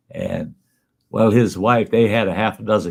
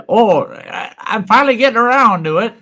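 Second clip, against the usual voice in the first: put-on much higher, louder, fast old man voice; old man punchline; 13 semitones higher, 5 dB louder than usual. Old man delighted about his late finish, high and fast. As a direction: squeal it out gleefully.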